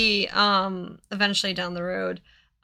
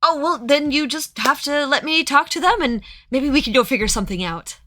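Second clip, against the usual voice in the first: higher, louder coaxing merchant; 6 semitones higher, 4 dB louder, faster than usual. Acted. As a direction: wheedle your way in. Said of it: "And he's like".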